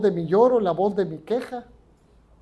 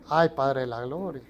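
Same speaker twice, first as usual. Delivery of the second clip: mock dismay; 6 semitones lower, 6 dB quieter than usual. Mock exasperated exclamation.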